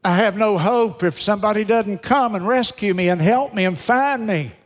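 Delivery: high, pleading like a drowning man; desperate plea. Acted. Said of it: "There was desperation in his voice, like a drowning man".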